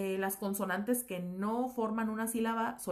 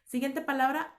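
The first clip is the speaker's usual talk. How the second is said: ordinary talk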